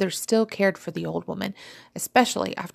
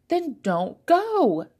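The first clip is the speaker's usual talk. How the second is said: high, slow, drawn-out retort; defiant retort; 10 semitones higher, 3 dB louder, much slower than usual. A narrator's reading. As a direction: refuse her with pointed slowness. Fizzy refusing her sister.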